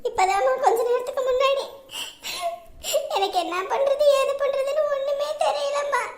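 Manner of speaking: helpless, sobbing voice; weeping, rising into a wail; crying. Acted. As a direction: plead helplessly through tears. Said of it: "எதுக்கு அழுதுகிட்டு இருக்கிற?".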